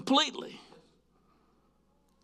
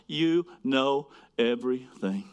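usual speaking voice

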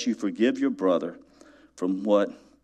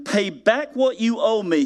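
demanding; 10 semitones higher than usual. Acted. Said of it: "he demanded".

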